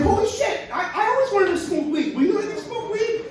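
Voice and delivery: very high laughing excited voice; eager gator. Acted. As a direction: beg like a giddy kid.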